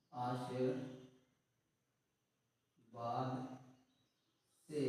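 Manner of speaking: word by word with long pauses; slow classroom dictation; slow syllable drill. Shown as a demonstration. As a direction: spell it out slowly for kids. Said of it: "repeats syllable by syllable, slowly".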